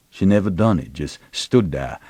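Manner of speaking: ordinary delivery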